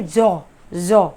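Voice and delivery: जौ drawn out very slowly with a buzzing z; slow demo of the z sound. Shown as a demonstration. Teacher models जौ for Hindi-speaking students, very slow. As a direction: stretch जौ slowly, buzz the z. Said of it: "जौ की जो आवाज़ है".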